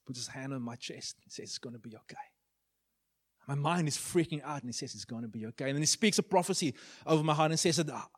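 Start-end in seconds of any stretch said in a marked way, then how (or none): 1.5-2.2 very soft, low comforting voice; quiet reassurance. Retold or imitated. Christ with a hand on his chest, softly reassuring. 4.9-5.7 low, soft comforting words; quiet reassurance. Retold or imitated. Christ answering a young man's freak-out, softly reassuring.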